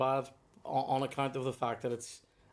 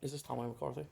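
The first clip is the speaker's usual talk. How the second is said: inner voice; 8 dB quieter than usual. Retold or imitated. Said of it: "in my head went".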